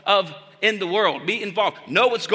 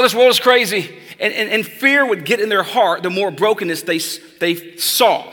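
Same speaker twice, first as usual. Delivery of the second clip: loud, rising pulpit exhortation; full-force preaching; 2 semitones higher, 6 dB louder than usual. Acted. Preacher urging the congregation not to fear, loud and insistent. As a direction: drive the warning home to the room.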